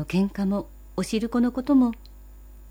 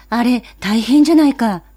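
put-on alarmed boy; 3 semitones higher, 8 dB louder than usual.

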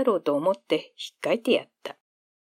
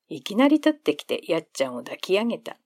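ordinary delivery, nothing marked

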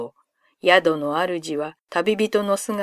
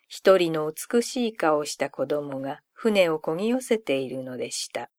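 plain reading voice

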